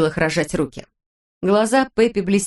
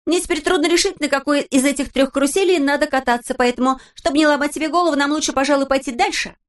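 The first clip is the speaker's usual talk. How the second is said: put-on high, lively girl's voice; Pippi voice; 8 semitones higher than usual. A narrator's reading. Narrator voicing Pippi, high and perky. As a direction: play Pippi as a cheeky girl.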